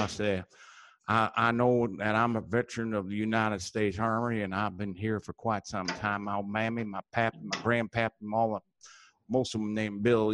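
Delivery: put-on Southern backwoods old-timer drawling on; folksy hillbilly accent; hillbilly character. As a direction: play a rambling country veteran from the hills. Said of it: "I got a hat for that character".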